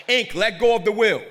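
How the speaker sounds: loud, high, forceful command; urgent command. Acted. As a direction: voice the spirit's urgent order. Acts out command felt in spirit.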